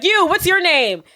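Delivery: a demanding editor singling her out; slowed mock boss asking her name; bossy boss. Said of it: "he's like".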